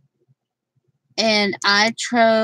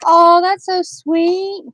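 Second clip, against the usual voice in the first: higher, louder and slow; hammed-up husband gushing; mock sweet husband; 8 semitones higher, 5 dB louder, slower than usual. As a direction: imitate the husband's cooing. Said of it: "He's like".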